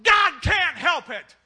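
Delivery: slow, loud mocking declaration; mocking shout. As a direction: bellow it out slowly in scorn. Preacher mocking newspaper headline.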